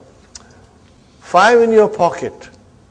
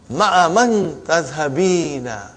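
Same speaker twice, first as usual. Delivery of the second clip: slow model reading; slower than usual. Shown as a demonstration. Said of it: "teacher models sentence slowly".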